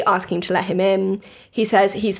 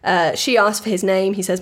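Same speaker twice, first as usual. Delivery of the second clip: ordinary talk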